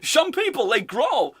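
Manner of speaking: put-on high, slushy-S Dutch accent; mock Dutch accent. Host mimicking the clip just played, mock Dutch accent. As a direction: echo the manager in hammed-up Dutch. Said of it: "he loves it".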